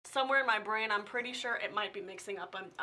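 ordinary talk, nothing marked

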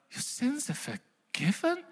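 soft, unbelieving question rising high; hushed disbelief. Pretend. Crowd member repeats Jesus's pardon, hushed and incredulous. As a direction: echo the words as if they can't be true.

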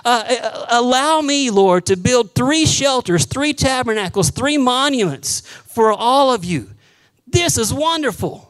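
excited Peter. Acted. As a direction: gush like Peter overwhelmed on the mountain. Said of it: "And Peter goes".

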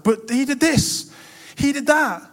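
high, slow complaining brother; whiny older brother. Retold or imitated. Older brother protests to father about his sibling, high whine. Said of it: "he comes and goes like".

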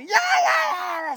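hammed-up crowd yelling; mock yell. Retelling people yelling over him.

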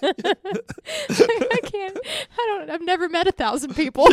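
high, loud, laughing through the words; cracking up laughing. Real, not acted. Cracking up at the idea of a thousand friends, laughing helplessly. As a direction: barely get it out for laughing.

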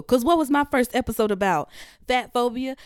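normal talking voice

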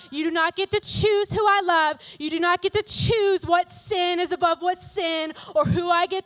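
firm, rising preacher intensity; God's stern rebuke. Acted. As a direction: hammer it home as God's rebuke.

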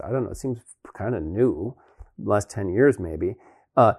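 ordinary talk

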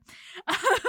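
real laugh. Real, not acted. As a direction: laugh out at the joke. Laugh after mock reaction.

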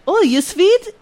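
put-on high, lightly Swedish-accented question; a mild Swedish accent asking. Swedish customer asks cashier, light Swedish accent. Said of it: "so he was like".

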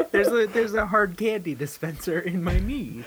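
laughing through the words; real laughter while joking about a candy knee. Real, not acted.

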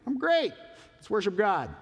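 mock church cheer, high and loud; fake cheerful.

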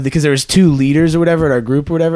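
ordinary talk

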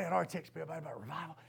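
ordinary talk

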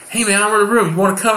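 high, upbeat invite; friendly promoter. Retold or imitated. Promoter invites an angry comic, high and upbeat. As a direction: chirp the invitation brightly. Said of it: "this guy's like".

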